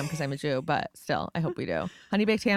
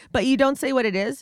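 normal talking voice

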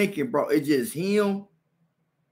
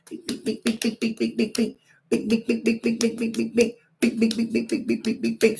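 rapid higher bing bing punch noises; punch sounds; 8 semitones higher than usual. Retold or imitated. Retelling the brawl, rapid punch noises. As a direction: rattle off the punches.

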